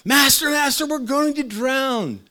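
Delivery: high, loud, frightened cry for help; panicked shout. Retold or imitated. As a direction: cry out like sinking sailors. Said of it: "woke him up shouting".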